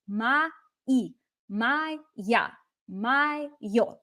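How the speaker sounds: мои, моя, моё each split into two beats; careful teacher's model of мои, моя, моё, stressed at the end; syllable-by-syllable pronunciation. Shown as a demonstration. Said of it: "two syllables".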